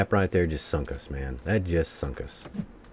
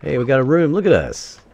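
excited; 9 semitones higher, 7 dB louder than usual. Real, not acted. Excited about getting a room.

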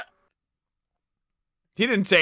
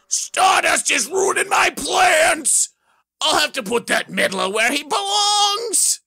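rage; 6 semitones higher than usual. Acted. Villain ranting in a rage at his foiled plans. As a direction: growl out the villain's fury. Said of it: "he goes into a rage".

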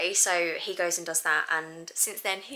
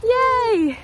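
cheerful yay; 8 dB louder than usual. Real, not acted. Cheering winning the race.

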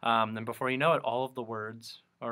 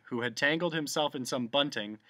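usual speaking voice

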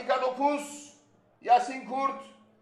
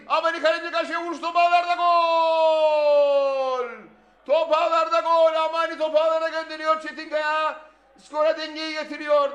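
screaming football commentator; 5 semitones higher, 6 dB louder than usual. Real, not acted. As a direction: roar the goal and keep the excitement. Goal call.

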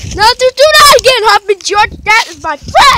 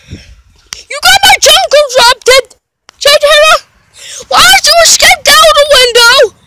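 raised, high-pitched play telling-off; mock angry scolding; 6 semitones higher, 4 dB louder than usual. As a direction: scold a runaway in pretend fury. Acted quarrel with escaped character.

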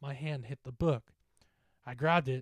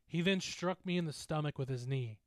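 plain reading voice

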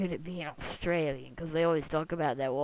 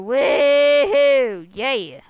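high, loud whoop of joy; woohoo cheer; 9 semitones higher, 18 dB louder, much slower than usual. Real, not acted. Gamer flying high, whooping loud.

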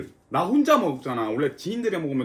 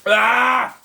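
sharp loud cry at the heat; spicy yelp; 8 semitones higher, 10 dB louder than usual. Real, not acted. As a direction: yell out from the spice. Yelps at spicy bite.

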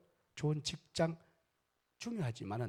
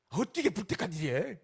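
sharp, louder mock scold; scolding mother-in-law; 4 semitones higher, 5 dB louder than usual. Unwise mother-in-law scolding the bride, mock sharp. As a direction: snap at her like a harsh mother-in-law.

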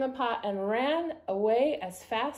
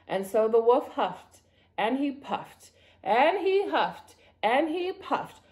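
drawn-out, building suspense; dramatic storybook narration; 3 semitones higher than usual. A narrator's reading. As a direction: stretch out each huff and puff for children. Narrates the climax.

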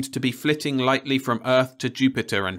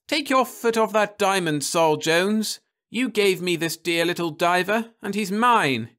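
put-on high, possessive young woman, a touch louder; Florence's voice; 10 semitones higher, 4 dB louder than usual. A narrator's reading. Wife warning her husband off her diver, high and possessive. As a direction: claim it sharply as headstrong Florence.

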